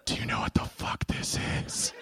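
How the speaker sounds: baffled, unvoiced-sounding stage whisper; mock whisper. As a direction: whisper it like a secret text to buddies. Voices doctor texting her buddies.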